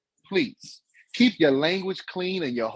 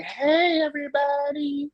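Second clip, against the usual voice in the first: very high, drawn-out mock greeting; teasing high-pitched hello; imitating co-host's greeting; 12 semitones higher, slower than usual. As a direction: imitate her popping on with a high squeal. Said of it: "She might pop on and be like".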